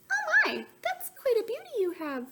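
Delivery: high, sweet little bird voice; a tiny bird calling across the river; little bluebird voice. A narrator's reading. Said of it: "called Bluebird".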